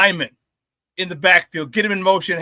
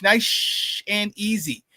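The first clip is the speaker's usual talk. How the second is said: slow, drawn out; 4 dB quieter, much slower than usual. Shown as a demonstration. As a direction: stretch each word out slowly.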